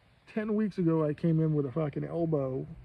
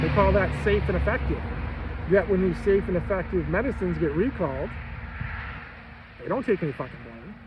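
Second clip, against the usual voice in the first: heated rant; 4 semitones higher, 4 dB louder than usual. Real, not acted. Ranting against pharmaceutical system.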